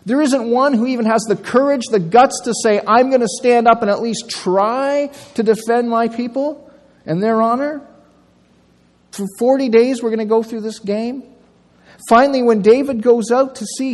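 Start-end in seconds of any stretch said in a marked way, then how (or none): 0.0-11.2 higher, louder sneering challenge; mocking taunt. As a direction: scoff at the cowards across the valley. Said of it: "He mocks them and says".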